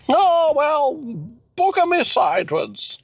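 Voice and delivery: high-pitched character exclamation; high, put-on surprise; Cecil, exclaiming. Plays Cecil.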